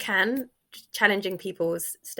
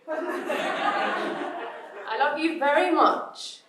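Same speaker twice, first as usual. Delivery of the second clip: drawn-out pleading; 7 semitones higher, much slower than usual. Retold or imitated. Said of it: "I'm always like".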